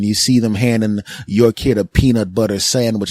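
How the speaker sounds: ordinary delivery, nothing marked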